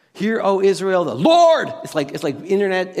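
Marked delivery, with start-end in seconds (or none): 0.1-1.7 emphatic build to a yelled LORD; mock yelling. Shown as a demonstration. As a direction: read it as all caps, LORD shouted. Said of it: "internet yelling".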